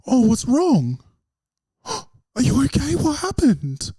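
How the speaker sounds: high-pitched, gasping worried fussing; mock concern. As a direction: fuss over someone in exaggerated alarm. Acts out worried people approaching him.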